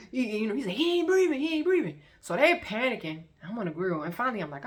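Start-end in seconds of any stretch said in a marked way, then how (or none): 0.8-2.0 high, loud panicked repeat; panicked cry. Retold or imitated. Cousin shaking Max, panicking that he isn't breathing. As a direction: yell it in rising alarm.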